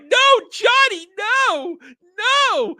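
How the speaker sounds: very high, loud stretched cries; mock-tragic shrieking; hammed-up anguished scream. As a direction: overplay the horror of finding him dead. Lisa finds Johnny dead.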